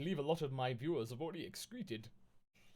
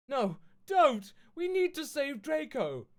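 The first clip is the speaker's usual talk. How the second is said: much higher and louder, slower desperate cry; pleading shout; 13 semitones higher, 8 dB louder, slower than usual. Acted. Girls begging Dumbledore to save Draco, hammed-up shout. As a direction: beg him to save Draco.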